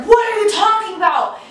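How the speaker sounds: high-pitched, defensive protest; fighting back. Retold or imitated. Boyfriend answers his girlfriend's accusation, acted indignant denial. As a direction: imitate an indignant boyfriend in a row. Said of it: "they start fighting".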